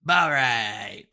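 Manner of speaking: loud, drawn-out streamer catchphrase; Jinxie catchphrase. Acted. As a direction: belt out an imitation of a streamer's drawn-out sign-on. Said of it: "If any of you guys watch Jinxie".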